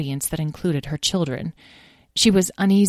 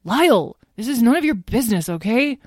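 high, louder, quick rebuke; annoyed sibling; 6 semitones higher, 4 dB louder than usual. Acted. Azrael telling Lyle to stay out, sharply annoyed. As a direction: shut the kid brother down fast. Sick brother snaps at Lyle.

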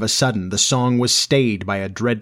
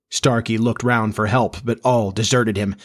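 usual speaking voice

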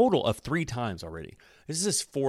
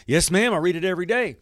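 higher, louder and faster, eager to please; eager grandson; 8 semitones higher, 6 dB louder, faster than usual. Retold or imitated. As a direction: rattle it off like a grandson fibbing to grandma.

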